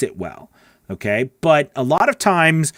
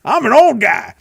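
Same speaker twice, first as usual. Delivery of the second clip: lightly voiced old man, pitched up and louder; a hint of a creaky old-timer, played for laughs; mock old guy; 11 semitones higher, 5 dB louder than usual. Host joking to chat that he's an old guy, lightly voiced old man. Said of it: "maybe feel like".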